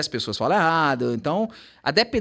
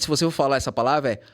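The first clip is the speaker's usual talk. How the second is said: ordinary talk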